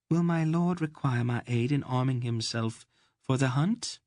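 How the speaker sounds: overly polite to insult him; stilted and insulting. Acted. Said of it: "in the stilted and insulting manner".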